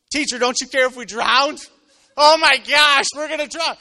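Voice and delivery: loud, high-pitched cry of alarm; hammed-up terrified shout; panicked shouting. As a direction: yell it in a panic, as if about to drown. Panicked disciples waking Jesus in storm.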